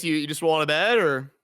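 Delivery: ordinary delivery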